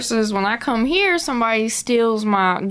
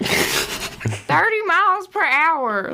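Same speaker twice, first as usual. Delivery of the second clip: slow drawl; 7 semitones higher, slower than usual. Shown as a demonstration. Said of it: "they call it the drawl".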